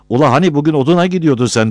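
fast, high, loud challenge; heckling. Acted. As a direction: call out a needling question. Villager heckles from the roof.

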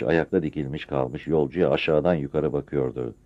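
plain reading voice

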